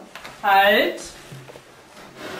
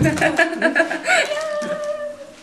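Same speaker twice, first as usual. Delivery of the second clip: high laugh. Real, not acted. Mother bursts out laughing.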